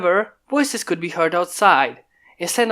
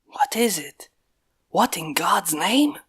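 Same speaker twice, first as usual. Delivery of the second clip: hushed, breathy whisper while hiding; 4 dB quieter, slower than usual. Acted. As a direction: whisper so nobody nearby hears. Hiding, still sneaking.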